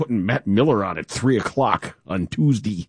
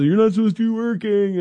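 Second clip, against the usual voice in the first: mock scolding family; 10 semitones higher than usual. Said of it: "my wife and kids are mad at me".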